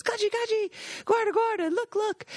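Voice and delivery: high, eager calls across the woods; excited calling out. Retold or imitated. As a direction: call him over, thrilled at the find. Calling him over in woods.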